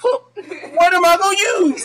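high, drawn-out baffled plea; exaggerated bewildered whine through laughter; mock confusion. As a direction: imitate a helplessly confused man, slow and high. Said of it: "he got the confused look like".

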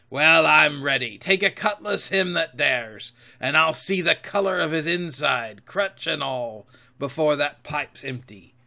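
loud, high pirate harangue easing off at the end; fierce old sea dog's challenge; Silver, challenging captain. Acted. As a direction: dare the crew to fight.

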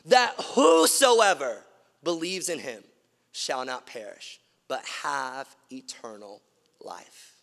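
slow and deliberate, fading down to a whisper; solemn scripture recitation. Acted. Preacher reciting John three sixteen to the congregation, slow and fading to a whisper. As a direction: let the verse build, then sink to a hush.

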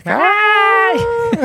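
high, loud imitation scream; long, high-pitched mock yell from afar; mock distant scream. Mom off in the distance at the skating, mock scream. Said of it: "just screaming like".

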